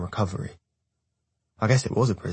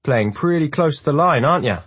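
higher, louder taunt; 7 semitones higher, 7 dB louder than usual. A narrator's reading. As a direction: call out a jeering challenge. Jackson taunting across the room.

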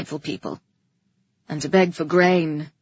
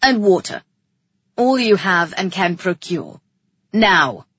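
loud, lowered command; ordering; 3 semitones lower, 6 dB louder than usual. A narrator's reading. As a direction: royal order to a servant. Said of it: "he ordered".

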